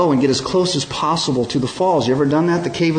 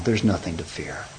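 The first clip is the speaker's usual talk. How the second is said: softer, tender "Don't be afraid"; gentle reassurance; 5 semitones lower, 5 dB quieter than usual. Acted. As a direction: comfort a frightened friend. Jesus reassures, softly.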